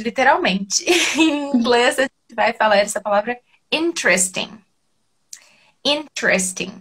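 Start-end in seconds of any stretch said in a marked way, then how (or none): 3.7-4.5 interesting as IN-tres-ting; interesting in three syllables. Shown as a demonstration. Teacher models interesting with three syllables. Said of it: "O que acontece muito". 5.8-6.7 interesting as IN-tres-ting; interesting in three syllables. Shown as a demonstration. Teacher models interesting with three syllables. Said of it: "Vai do T pro R".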